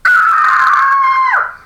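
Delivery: high, loud shriek of horror; anguished scream. Acted. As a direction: scream as if your hair just vanished. Hair zapped off.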